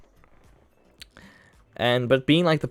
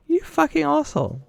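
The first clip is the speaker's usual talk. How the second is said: much higher squeaked curse; mock squeaky insult; 3 dB louder than usual. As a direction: spit the insult out in a cartoonish pitch. Inner insult at the opponent.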